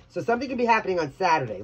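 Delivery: usual speaking voice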